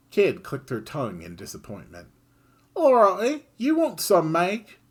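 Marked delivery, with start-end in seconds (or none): 2.7-4.8 high and louder, cheeky offer; Kid's voice. Acted. Kid offering Serge tomorrow's lunch, cheeky and high. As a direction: tempt him with the food.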